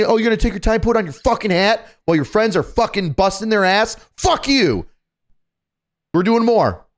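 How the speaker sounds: high-pitched sarcastic drill sergeant rant; retold drill sergeant scolding; mock berating. Acting out berating slow trainees.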